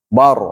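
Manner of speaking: "Bar" with a full, heavy r; thick heavy r. Shown as a demonstration. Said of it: "dibacanya lebih tebal". ("Bar" dibaca tebal)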